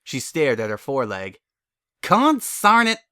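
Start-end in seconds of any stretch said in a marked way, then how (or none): 2.0-3.0 frustrated outburst. Acted. Ring forces her to face Twilight.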